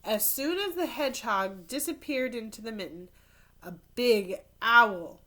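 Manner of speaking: put-on lively storybook narration for kids; animated read-aloud; reading to children. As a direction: tell it like a picture book at story time. Reads picture book aloud.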